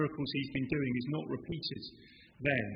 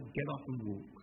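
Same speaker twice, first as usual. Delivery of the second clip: ordinary delivery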